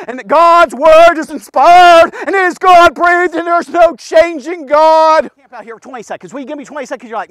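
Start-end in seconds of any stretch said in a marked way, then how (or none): 0.1-5.3 much louder, slow, proclaiming; preaching at full force. Real, not acted. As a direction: thunder it from the pulpit. Preacher declaring scripture's power.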